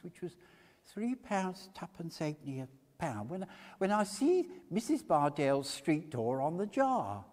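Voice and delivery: eager humble witness voice; high fussy old woman witness. A narrator's reading. Mrs Cluppins testifies.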